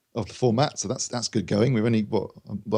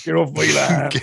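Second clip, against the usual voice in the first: louder, higher West Country farmer; West Country accent; 5 semitones higher, 4 dB louder, slower than usual. Retold or imitated. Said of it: "You can't do that in a West Country accent".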